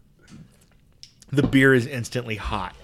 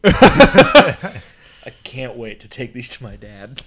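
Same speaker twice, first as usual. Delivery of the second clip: laughing; 9 dB louder, much slower than usual. Real, not acted. Laughing while speaking.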